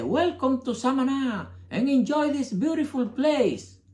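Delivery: anuncio imitado, agudo y lento, con acento samanés; voz alta y pausada de anuncio turístico; inglés de Samaná. Retold or imitated. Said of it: "y uno dice". (imitando al viejo samanés del anuncio, con acento samanés)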